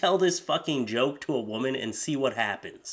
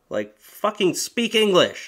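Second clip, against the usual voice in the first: higher, louder, slow outburst; fed-up yell; exasperated; 7 semitones higher, 5 dB louder, slower than usual. Real, not acted. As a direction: snap at them in disbelief. Exasperated rant.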